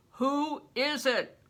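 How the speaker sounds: slow, guarded voice from within; wary voice behind door. A narrator's reading. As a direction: ask it slowly, suspicious and hidden.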